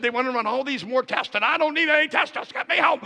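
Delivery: retold indignant complaint, pitch climbing; mock wifely protest.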